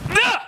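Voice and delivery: short high kihap shout; explosive coach's battle cry; demonstrated kick yell. Shown as a demonstration. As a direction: yell sharply as the kick lands.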